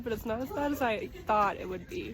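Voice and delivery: ordinary talk, nothing marked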